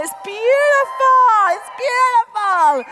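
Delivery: loud, high rave about scenery; gushing delight. Real, not acted. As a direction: gush loudly, drawing it out. Gushing over the coast.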